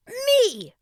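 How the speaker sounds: high, loud held word; exclaimed. A narrator's reading. Boy completes king's prompt, exclaiming. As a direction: shout the answer out. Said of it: "Russ exclaimed".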